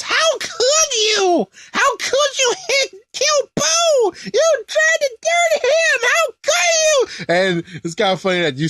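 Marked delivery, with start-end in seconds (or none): 0.0-7.2 high squeaky mock Babidi. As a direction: shriek it like a tiny furious wizard. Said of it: "he also says".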